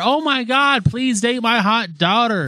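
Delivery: high, slow pleading mother voice; mocking mother.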